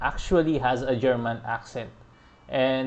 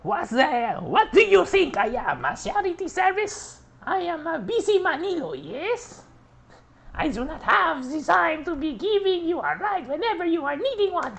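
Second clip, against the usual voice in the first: put-on high, indignant German-accented rant; hammed-up high German accent, outraged. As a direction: Marlock scolding in a mock German accent. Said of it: "reading Marlock's lines is kind of fun".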